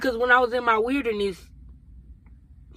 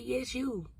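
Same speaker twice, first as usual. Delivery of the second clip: hushed reply; 13 dB quieter than usual. Retold or imitated. Retelling God's quiet answer to her, hushed and gentle. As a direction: say it under your breath as a quiet affirmation.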